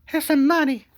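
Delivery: very high, loud mock lady voice; falsetto woman. Wife asking security to unload the husband, in a high falsetto. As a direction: chirp it sweetly like nothing happened. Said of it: "she's like".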